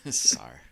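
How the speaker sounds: "Sar" low and loud with an R on the end; added Boston R. Shown as a demonstration. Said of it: "we add R's in other words".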